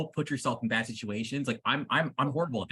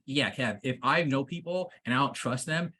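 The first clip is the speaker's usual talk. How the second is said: ordinary delivery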